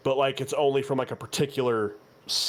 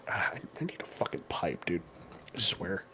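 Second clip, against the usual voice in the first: hushed whisper under the breath; whispered aside; 6 semitones lower, 9 dB quieter than usual. Real, not acted. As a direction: whisper it off to the side. Out-of-character aside to players.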